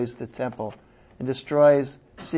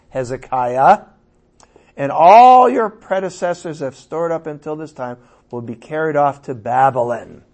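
declaiming scripture, louder and higher; booming prophetic reading; 4 semitones higher, 8 dB louder than usual. Acted. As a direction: proclaim it like a prophet's oracle. Reads prophecy to Hezekiah aloud.

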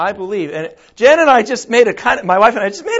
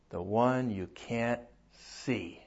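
much lower and softer, slow; hushed, solemn answer; 11 semitones lower, 18 dB quieter, slower than usual. Acted. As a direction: Noah answering his wife in awe. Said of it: "Noah's wife says".

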